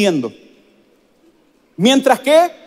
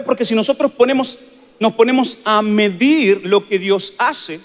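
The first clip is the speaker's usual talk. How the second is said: ordinary delivery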